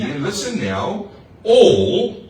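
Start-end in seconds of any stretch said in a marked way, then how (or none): 1.4-2.2 "all" punched loud and high; stress on all. Shown as a demonstration. Preacher stressing "all" to the congregation. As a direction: lean hard on "all". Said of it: "that means everybody".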